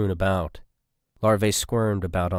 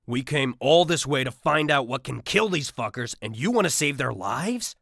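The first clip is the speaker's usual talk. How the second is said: snarling; 6 semitones higher than usual. A narrator's reading. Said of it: "snarled Marks".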